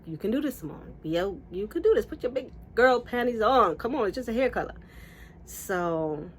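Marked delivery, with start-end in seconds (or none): 0.0-4.7 raised, urging pep talk to oneself; mock-bracing encouragement, pitched up; self pep talk. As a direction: talk yourself into it, pushing harder. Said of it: "So I said to myself".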